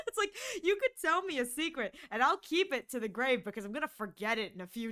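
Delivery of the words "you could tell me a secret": normal talking voice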